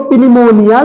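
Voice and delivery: pneumonia with the P sounded; wrong pronunciation. Retold or imitated. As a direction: say pneumonia as "pi-ni-monia", P pronounced. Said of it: "pneumonia नहीं बल्कि निमोनिया होता है".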